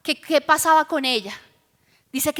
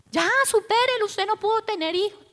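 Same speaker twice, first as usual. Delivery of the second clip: higher-pitched, exasperated brush-off; mock dismissive scolding; 6 semitones higher, faster than usual. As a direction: imitate an impatient husband telling her to get over it. Imagined husband dismissing his wife's grief.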